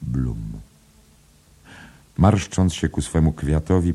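plain reading voice

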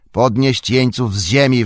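loud, high yelled order; orc's yell. A narrator's reading. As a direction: scream the command across the camp. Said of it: "wrzasnął Ugluk".

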